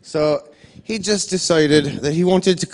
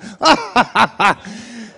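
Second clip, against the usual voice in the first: a short delighted laugh at his own mischief; gleeful cackle; 7 semitones higher than usual. Retold or imitated. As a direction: cackle at the cruelty of it.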